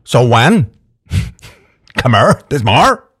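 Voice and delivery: put-on Northern Irish character; hammed-up Northern Irish; Northern Irish accent. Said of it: "Back into character".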